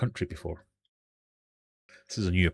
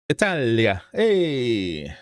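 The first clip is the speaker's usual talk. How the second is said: playful mock Italian exclamation; Italian accent; 3 dB louder, much slower than usual. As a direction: put on a hammy Italian accent. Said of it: "That's my Italian accent".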